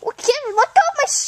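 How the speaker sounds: high, fast, huffy princess; indignant princess. Acted. Cinderella scolds the kid pulling her shoe, indignant. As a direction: snap at the kid to let go.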